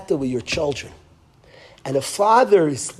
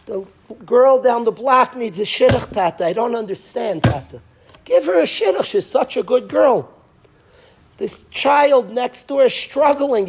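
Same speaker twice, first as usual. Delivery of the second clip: pleading cry; 4 semitones higher than usual. Retold or imitated. Rav Levi Yitzchak crying to Hashem at the Seder, pleading. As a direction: beg your father in heaven, near tears.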